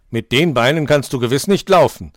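loud scornful taunt; jeering. A narrator's reading. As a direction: hare shouting mockery at the tortoise. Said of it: "höhnte er weiter".

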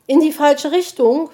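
high, loud echoing question; astonished. A narrator's reading. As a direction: repeat it in disbelief, pitch rising. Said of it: "wiederholte die Elster erstaunt".

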